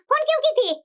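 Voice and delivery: ordinary talk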